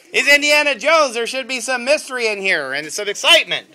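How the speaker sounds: loud, high, fast and eager; excited. Real, not acted. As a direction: pump up the excitement. Parent coaching with excitement.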